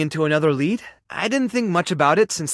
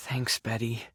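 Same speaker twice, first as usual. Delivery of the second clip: very soft, low and deflated; dejected thanks; 6 semitones lower, 10 dB quieter than usual. Acted. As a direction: sigh it out, disheartened. Disappointed thanks after sighing.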